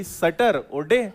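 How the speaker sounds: सटर और डे split slowly into "satar" and "day"; सटर और डे spelled out, laughing; wrong pronunciation. Shown as a demonstration. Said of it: "फिर वही बात है भाई". (सटर और डे धीरे, हंसते हुए)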